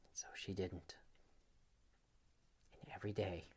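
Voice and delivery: very soft, breathy whisper. A narrator's reading. Storyteller to child, soft whisper. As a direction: drop to a conspiratorial whisper. Hushed suspense in bedtime story.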